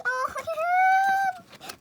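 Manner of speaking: high, loud, slow squealing cry; excited shriek. Real, not acted. Fan overwhelmed by an idol photo, shrieking. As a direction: wail it out in delight.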